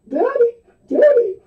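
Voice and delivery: put-on high whining child cry; whiny kid voice. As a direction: whine it like a little kid. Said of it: "He had this really whiny voice".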